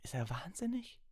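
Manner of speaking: very high, airy squeak; gasped disbelief. A narrator's reading. Ron doubting Dumbledore's sanity, high and aghast. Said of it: "schnappte Ron".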